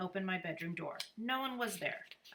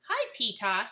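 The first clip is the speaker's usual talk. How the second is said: put-on light, higher little-boy voice; a cheerful four-year-old greeting; lightly voiced small child; little brother's voice; 4 semitones higher, 8 dB louder than usual. A narrator's reading.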